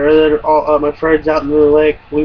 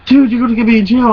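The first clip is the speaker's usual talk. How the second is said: higher, quick protest; alarmed warning; 6 semitones higher, 4 dB louder, faster than usual. Retold or imitated. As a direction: blurt it out fast and pitched up. Retold alarmed warning to friend.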